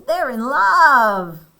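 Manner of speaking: high, loud, drawn-out storybook voice; gushing announcement. Acted. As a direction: stretch it out dreamily and loudly. Piggy announces birds' love.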